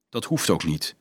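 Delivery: low, soft, calm reply. A narrator's reading. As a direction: answer calmly, dropping pitch and volume. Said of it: "zei Leonardo kalm".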